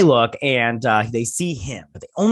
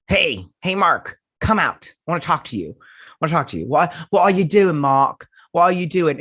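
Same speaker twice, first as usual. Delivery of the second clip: higher, louder acted demand to come out; calling out insistently; 5 semitones higher, 4 dB louder than usual. Steven talking to Mark in the mirror, calling out insistently. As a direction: call him out, pleading for answers.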